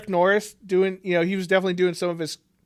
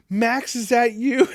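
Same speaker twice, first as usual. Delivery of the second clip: higher, drawn-out call; calling out tentatively; 6 semitones higher, 3 dB louder, slower than usual. Acted. Patricia calls to someone outside, calling out tentatively. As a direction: slowly call out to a companion.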